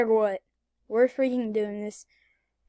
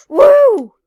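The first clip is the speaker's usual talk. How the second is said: whoop; 11 semitones higher, 18 dB louder than usual. Real, not acted. Celebrating the escape.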